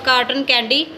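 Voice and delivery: normal talking voice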